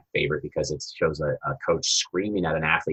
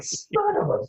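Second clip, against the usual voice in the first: drawn out, slow and high, laughing astonishment; amused disbelief; much slower than usual. Retold or imitated. Dad outwitted by his calm son, slow amused disbelief. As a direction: say it slowly in stunned amusement. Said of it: "And I'm like".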